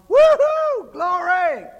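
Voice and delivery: very high, loud whooping glory; hooping and hollering. Acted. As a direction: whoop it up like a rowdy worshipper. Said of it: "hooping and hollering".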